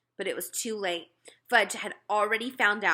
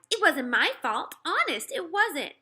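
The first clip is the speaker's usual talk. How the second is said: put-on upset girl; 9 semitones higher, 6 dB louder than usual. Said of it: "Sheila cried".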